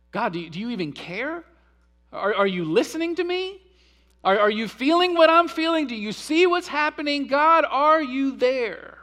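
desperate rising pleas, stretched out at the end; crying out to God. Retold or imitated. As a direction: voice the psalmist begging a silent God to answer. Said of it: "That's what he's crying out".

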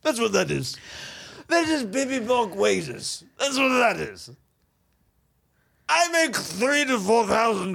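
affected, haughty millionaire voice; pooh-pooh it in a pompous rich-man voice; dismissive rich snob. Acted.

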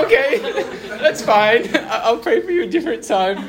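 high, loud, laughing through the words. Retold or imitated. Teen brushing off neighbour's refusal, laughing high. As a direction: laugh off the awkward rejection. Rejected prayer offer, laughing.

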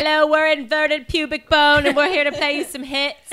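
lightly voiced rock frontman; rock band voice. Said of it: "I don't know why they talk like that".

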